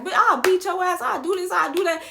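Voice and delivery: high, feisty mock threats; cussing him out. Drunk passenger threatening the driver, feistily.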